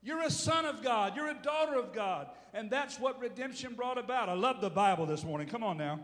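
normal talking voice

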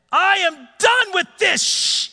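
high, loud and slow, snapping at the machine; fed-up outburst. Retold or imitated. Nearly crushed by the boom, he yells he's done, loud and slow. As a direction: let it burst out, slow and loud, done with it all. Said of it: "and I said it out loud".

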